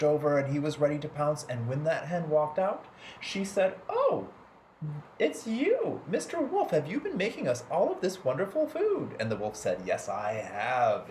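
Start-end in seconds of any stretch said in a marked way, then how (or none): 3.9-9.2 high, sweet mother hen greeting. A narrator's reading. Hen greeting Mister Wolf at the door, high and sweet. 9.8-11.1 low, slow wolf rumble; ferocious wolf voice. A narrator's reading. Wolf answering the hen at her door, low and ferocious. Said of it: "in this most ferocious wolf voice".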